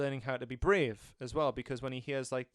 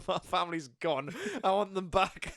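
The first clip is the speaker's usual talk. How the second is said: mock plea through laughter, a bit higher; laughing while quoting; 6 semitones higher, 5 dB louder than usual. Retelling the kid's wish, laughing through the plea. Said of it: "he's like".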